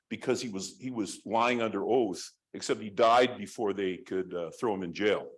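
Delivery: usual speaking voice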